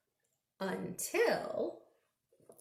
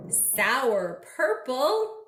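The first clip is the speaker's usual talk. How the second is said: slow, louder drawn-out clue; slow teasing; 4 dB louder, much slower than usual. Acted. Reading a clue to kids, slow and teasing. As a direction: stretch it to build suspense.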